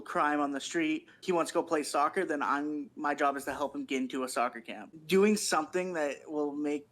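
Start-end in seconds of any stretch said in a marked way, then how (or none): none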